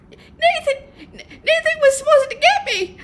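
very high, stammering stage whisper; panicked whisper. A narrator's reading. Rookie explaining herself to Paul Newman, panicked whisper. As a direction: whimper an excuse in a high whisper. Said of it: "I whispered back".

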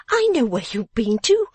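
cried accusation. A narrator's reading. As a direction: accuse him in a high, knowing voice. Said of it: "cried Miss Wren".

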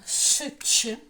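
ш and ч as two separate loud hushing sounds; double sound split. Shown as a demonstration. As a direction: sound out «ш», then a sharp «ч». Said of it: "Теж подвійний". (окремо голосно «ш», потім «ч»)

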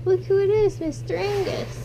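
light up at seeing the pet; excited pet greeting. Real, not acted.

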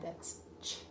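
"ch" breathed soft and voiceless; unvoiced ch. Shown as a demonstration. Teacher showing the child the "ch" sound. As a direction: hiss out "ch". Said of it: "C H at the end".

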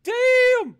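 loud high amazed exclamation; shocked yell; stunned. Real, not acted. Shock at big donation.

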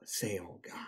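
breathy whispered gasp; hushed, under the breath. Real, not acted. Reacting to strawberry oils.

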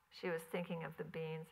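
plain reading voice

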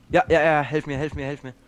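ordinary talk

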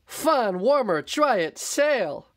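high, bright sales pitch; excited shopkeeper. Acted. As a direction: pitch it eagerly. Wilbur selling clothes.

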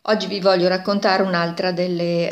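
usual speaking voice

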